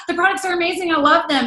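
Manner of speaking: high-pitched mock gushing; gushing fan. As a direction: imitate a thrilled new recruit. Imitating an over-excited team member.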